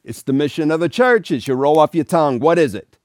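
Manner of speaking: a lightly done priest egging on his students; impatient priest. Retold or imitated. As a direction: press the class for the answer. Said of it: "He went".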